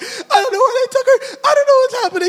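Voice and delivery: high, hammed-up wailing into the phone; exaggerated tearful whine; mock crying. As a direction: blubber it out comically, ending in fake sobs. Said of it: "I just started crying on the phone".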